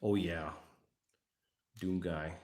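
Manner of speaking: ordinary talk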